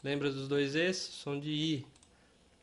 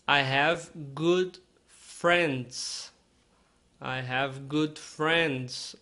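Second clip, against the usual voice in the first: very slow, careful English model line; slow model sentence; 3 dB louder, much slower than usual. Shown as a demonstration. Teacher modelling slowly for Brazilian learners. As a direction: stretch each word for learners to copy. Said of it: "aqui você quase não fala o i".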